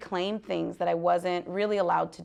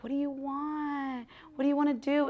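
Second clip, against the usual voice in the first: gentle asking; 5 semitones higher, 6 dB quieter than usual. Retold or imitated. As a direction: ask a small child kindly. Asking her inner child gently.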